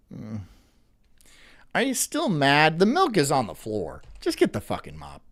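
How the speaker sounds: ordinary delivery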